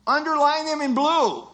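acted bright-idea shout from the class; eager answer. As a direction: blurt it out proudly like a keen student. Acting a student's eager answer.

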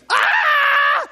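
loud, high scream of terror. Shown as a demonstration. Preacher showing how to cry out for fear, loud scream. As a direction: demonstrate a full fearful shriek. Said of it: "You just scream".

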